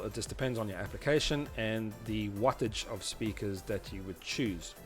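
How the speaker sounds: usual speaking voice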